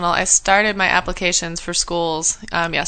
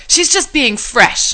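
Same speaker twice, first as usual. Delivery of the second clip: friend's voice; 4 semitones higher, 3 dB louder, much slower than usual. Retold or imitated. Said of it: "my friend Ariel was like".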